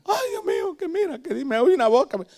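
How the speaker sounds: high, frantic wail; bloodied youths babbling in fear; panicked crying youths. Retold or imitated. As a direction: gasp it out in panic.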